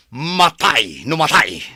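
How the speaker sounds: loud, do-or-die; villain shouting his last stand; defiant shout. Acted. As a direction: throw it out as a vow. Villain vows to fight to death.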